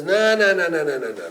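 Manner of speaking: flatly refuse, loudly and fast; stubborn raven says no to Noah, loud and insistent; loud refusal. Acted.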